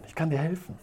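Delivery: soft little voice from behind; a small angel's voice, hushed; tiny voice calling softly. Acted. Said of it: "hört er so eine kleine Stimme von hinten".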